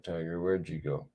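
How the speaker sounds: ordinary delivery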